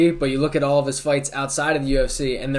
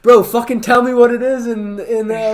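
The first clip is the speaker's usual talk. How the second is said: high, loud exasperated demand; exasperated shout; 9 semitones higher, 8 dB louder than usual. Retold or imitated. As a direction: yell it at your friends, fed up. Retold demand to betting friends.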